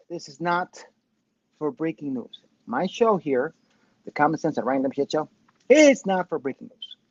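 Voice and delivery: usual speaking voice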